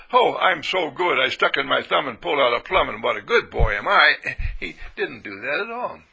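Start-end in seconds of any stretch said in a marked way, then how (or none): 0.1-4.2 smug boasting. Retold or imitated. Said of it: "he didn't feel".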